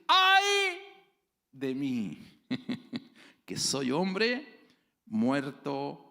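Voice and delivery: Isaiah crying out in anguish before the Lord; prophet's anguished cry. Acted. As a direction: cry out as a man undone before God. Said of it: "el versículo seis dice".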